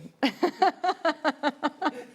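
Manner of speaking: long fit of giggles; real helpless laughing; amused laughter. Real, not acted. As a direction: crack up over a silly misprint. Amused by the misprinted name.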